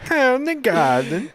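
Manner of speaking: high mock German accent. Shown as a demonstration. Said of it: "That's German".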